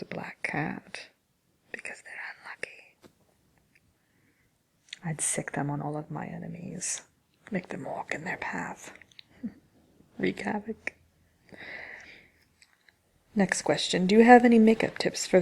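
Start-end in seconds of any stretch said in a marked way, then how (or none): 0.0-10.9 soft, wicked half-whisper; hushed scheming. Acted. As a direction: plot it quietly to yourself, relishing it. Witch plotting against her enemies.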